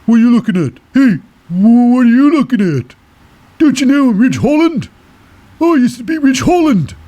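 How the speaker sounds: high, loud mouthing-off voice; hammed-up, high-pitched trash talk; Ridge Holland. Ridge Holland gives Solo Sikoa the mouth backstage, high mock-confrontational voice. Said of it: "the mouth".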